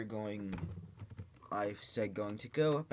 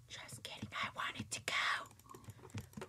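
whispering; 4 dB quieter, slower than usual. Real, not acted. Confessing aside.

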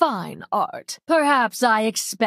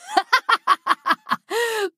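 high-pitched evil laughter; maniacal laugh; 12 semitones higher than usual. Acted. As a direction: cackle in high, quick bursts. Villain character's laugh.

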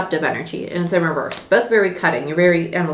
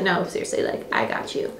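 hushed, softer reassurance; reassuring whisper; 8 dB quieter than usual. Retold or imitated. Adult self soothing the frightened inner child, whispered. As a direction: whisper comfort to a scared child. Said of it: "And you'll be like".

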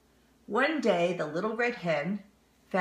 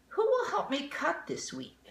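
hen voice; 11 semitones higher than usual. Acted. Hen asks, high voice.

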